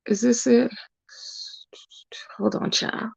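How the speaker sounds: hushed, absent-minded aside; whispered aside. Real, not acted. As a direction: whisper to yourself while searching. Muttering while searching for the post.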